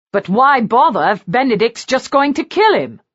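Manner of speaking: a brother demanding an answer. A narrator's reading. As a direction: press the question hard. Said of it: "Brandon demanded".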